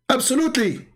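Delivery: high-pitched mock indignant reply; indignant opponent. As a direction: imitate an exasperated debater, voice shooting up. Retold exasperated chat-room opponent.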